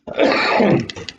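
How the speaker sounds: harsh chesty cough. Real, not acted. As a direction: cough into the mic. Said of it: "I've got a bad cough".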